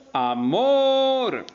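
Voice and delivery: "amor" with "mor" sustained, high and loud; stress on last syllable. Shown as a demonstration. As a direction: call out the "mor" of "amor" and hold it. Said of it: "tiene acento en la última sílaba". ("amor" con "mor" alargado, agudo y fuerte)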